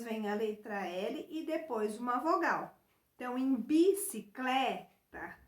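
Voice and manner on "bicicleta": "bicicleta" in syllables, leaning on CLE; stress on CLE. Shown as a demonstration. Teacher pointing pupils to the cle syllable, stressed and separated. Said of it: "a gente tem o cle".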